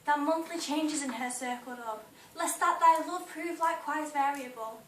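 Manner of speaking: projected, emphatic Juliet audition speech; dramatic monologue. Acted. Juliet pleading with Romeo on the balcony, dramatic monologue. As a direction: declaim it with passion, loud on the key phrases.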